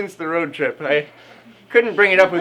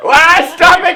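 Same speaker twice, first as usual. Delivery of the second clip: higher, loud, laughing shout; laughing protest; 12 semitones higher, 10 dB louder, slower than usual. Real, not acted. Reader begging the narrator to stop, laughing shout. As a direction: yell it while cracking up, begging them to stop.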